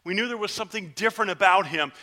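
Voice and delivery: normal talking voice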